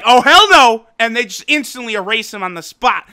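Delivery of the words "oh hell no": much louder, flat-out no; mock gods shutting it down loudly; loud refusal. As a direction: slam it down like a final ruling. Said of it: "The Zenosamas are just like".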